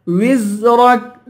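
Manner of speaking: وزرك slowly with a long buzzing ز; whistled za. Shown as a demonstration. Teacher showing the letter za, وزرك with whistling ز. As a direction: sustain the ز in وزرك, heavy ر. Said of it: "زا کی سیٹی نکالیں گے".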